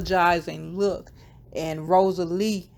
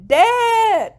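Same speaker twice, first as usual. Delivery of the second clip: high, loud drawn-out yell; hollered; 9 dB louder than usual. A narrator's reading. Allie hollers for Dad out the door, high drawn-out yell. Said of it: "and she hollered".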